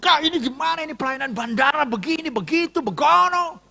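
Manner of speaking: high, rising tirade; acted phone complaint. Pastor phoning airport commissioner to complain, indignant. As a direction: rant down the phone, pitch climbing.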